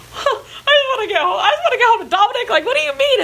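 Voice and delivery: high, whiny tearful plea; imitating your own tearful protest; mock crying. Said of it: "then I started to just cry".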